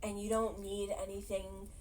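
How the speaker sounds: tender reassurance. Retold or imitated. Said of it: "he's like".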